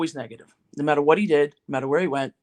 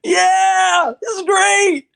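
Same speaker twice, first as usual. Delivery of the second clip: put-on high, loud whoops of a kid overjoyed; whooping and hollering; 9 dB louder, much slower than usual. Boy playing in his Christmas present, screaming and whooping. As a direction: holler it like an ecstatic little boy. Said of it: "screaming and hollering and whooping".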